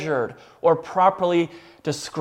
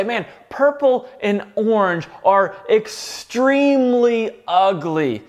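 slow, exaggerated stress on "extremely"; emphasis on "extremely"; 2 semitones higher, slower than usual. Shown as a demonstration. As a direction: drag out "extremely" for effect. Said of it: "we just add words like extremely".

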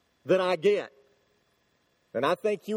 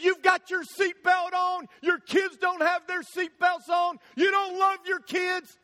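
accusing yell; 9 semitones higher than usual. Retold or imitated. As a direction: hammer the accusation at him. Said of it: "He just kept yelling".

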